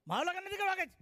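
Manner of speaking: a high, mock-indignant protest; indignant bride. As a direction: refuse the marriage in mock outrage.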